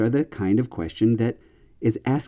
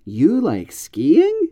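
skiing swoops way up, slow and higher; exaggerated echo question; 8 semitones higher, 4 dB louder, much slower than usual. Shown as a demonstration. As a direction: push the rise on skiing sky-high. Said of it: "I kind of exaggerated it there".

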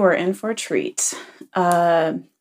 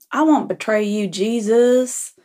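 lightly imitated sweet Southern accent; earnest old Southern man, lightly voiced; 3 semitones higher than usual. Teller mimics Peter pledging loyalty to Jesus, sweet Southern old man. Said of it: "sweet old Southern man".

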